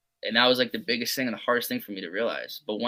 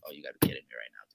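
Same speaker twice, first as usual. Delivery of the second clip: low, quick muttered complaint, much softer; frustrated mutter; 11 semitones lower, 11 dB quieter, much faster than usual. Retold or imitated. Hitter grumbling to himself after an out, quick mutter. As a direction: grumble it fast under your breath.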